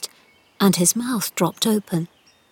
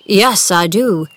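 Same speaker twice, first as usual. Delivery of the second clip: put-on slow gruff old man; 5 dB louder, slower than usual.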